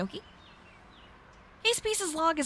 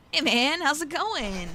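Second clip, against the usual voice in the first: ordinary talk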